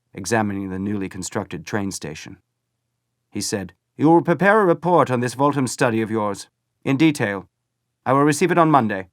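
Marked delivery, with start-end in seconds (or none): 4.0-9.1 raised, loud commanding voice; commanding leader. A narrator's reading. Hitler orders Ernst to report, loud and commanding. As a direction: bark out orders like a dictator.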